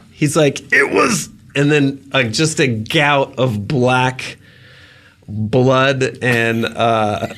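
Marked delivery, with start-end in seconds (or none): 0.7-1.7 a high strangled cry of one word; choking shout. Acted. Dying lord shouting one word to his cook. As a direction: shout it as the blood comes up. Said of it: "he shouts one word".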